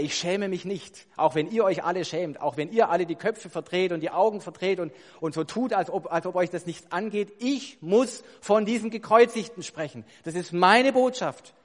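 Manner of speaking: loud, emphatic defiance, rising in pitch; defiant confession. Acted. Preacher as Paul to those who look away, defiant. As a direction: declare it forcefully against every doubter.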